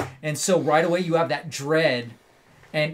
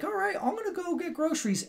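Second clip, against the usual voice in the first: much higher, breezy mom voice; mom's voice; 3 dB quieter than usual. Retold or imitated. Mom heading out for groceries, high and breezy. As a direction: chirp it like an unsuspecting mother. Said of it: "The mom's like".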